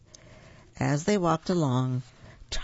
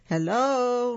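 higher, louder hello; a little louder; 9 semitones higher, 3 dB louder than usual. Acted. Lost Lawrence calling into the trees, higher and louder. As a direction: call out a bit more boldly. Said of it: "Lawrence said a little louder".

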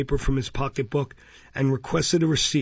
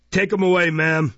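loud, high official command; imperious; 5 semitones higher, 5 dB louder than usual. A narrator's reading. Beadle ordering the child removed, imperious. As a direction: order it as one used to obedience. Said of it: "said Mister Bumble imperiously".

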